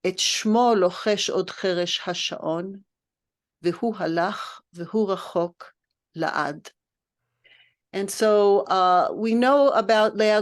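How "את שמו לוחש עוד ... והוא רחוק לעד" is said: quick, soft reading of Hebrew verse; קריאת שיר חרישית ומהירה; poem recitation. Acted. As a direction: recite the lines quietly, moving fast.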